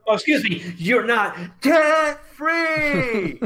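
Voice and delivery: mocking a friend for having debt, in a mock shocked shout; mock outrage. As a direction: stretch out the disbelief in a big fake shout.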